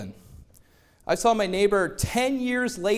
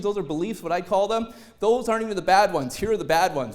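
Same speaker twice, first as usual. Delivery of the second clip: ordinary delivery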